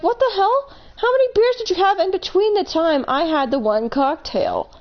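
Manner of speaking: high, disbelieving outburst; seeing her drunk friend stumbling, high and incredulous. Retold or imitated. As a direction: pitch up in shocked disbelief at him.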